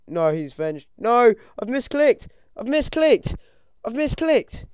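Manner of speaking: high, loud cry of panic; panicked yelling. Real, not acted. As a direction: wail in alarm at your own blunder. Misclick in a fight, panicking.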